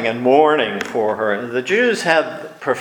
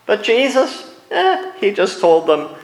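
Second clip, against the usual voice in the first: ordinary talk, nothing marked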